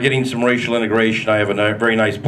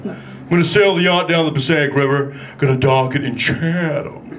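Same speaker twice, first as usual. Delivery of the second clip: snooty rich guy; 2 semitones higher than usual. Retold or imitated. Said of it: "Like Mister Hal from Gilligan's Island".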